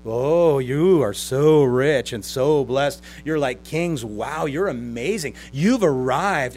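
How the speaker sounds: loud, slow, gushing sarcasm; mocking flattery. Preacher as Paul making fun of their bragging, exaggerated sarcastic awe. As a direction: draw out the fake admiration. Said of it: "It's like he's saying".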